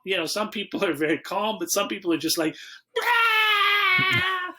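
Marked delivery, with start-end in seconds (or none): 3.0-4.5 high, loud imitation scream; panicked scream. Teller mimics panicking game contestants, high panicked scream.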